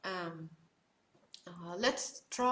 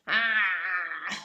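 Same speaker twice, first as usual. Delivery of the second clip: loud ah strangled in the throat; choked throat ah; 4 semitones lower, 5 dB louder than usual. Shown as a demonstration. Teacher repeating the Ain demo, choked ah. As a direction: squeeze it out like choking. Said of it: "So again".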